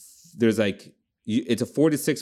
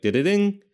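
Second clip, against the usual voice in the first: high, loud sing-song chime; imitated notification chime; 14 semitones higher, 5 dB louder than usual. Explaining an automatic game update, imitated high chime. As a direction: mimic a game alert rising high.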